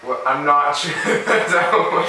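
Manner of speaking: laughing doubt mid-sentence. Real, not acted. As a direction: chuckle through the disbelief.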